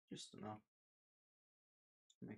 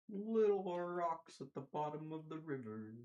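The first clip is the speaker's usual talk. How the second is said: dramatic voice; 6 semitones higher, 7 dB louder, slower than usual. Acted. Poetic slow line.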